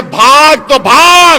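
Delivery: slow, loud, drawn-out challenge; drawn-out defiant roar; defiant shout. Real, not acted. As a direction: bellow it as a dare. Defiant rally line.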